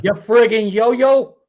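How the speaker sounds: slow, loud Brooklyn jab; mock Brooklyn insult; Brooklyn accent. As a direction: drag it out like a Brooklyn wiseguy. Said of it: "I could do the Brooklyn accent".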